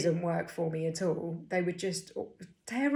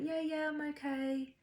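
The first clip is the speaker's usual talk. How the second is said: higher, airy "everything's fine" voice; breezy brush-off; 9 semitones higher, 4 dB quieter than usual. Retold or imitated. Imitating how she usually brushes off concern, higher and breezy. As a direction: chirp it out like nothing is wrong. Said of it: "usually I'll be like".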